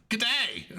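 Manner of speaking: high-pitched cartoon Aussie greeting; imitating an overkeen Australian assistant; over-the-top Australian accent. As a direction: chirp a hammed-up Aussie hello. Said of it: "doing the Australian thing".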